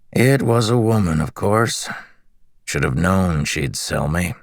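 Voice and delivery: lightly raspy, friendly prisoner; a hint of rasp, curious and chatty; raspy slave voice. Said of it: "He had a raspy voice".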